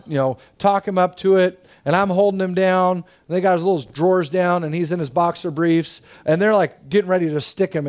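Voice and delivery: ordinary talk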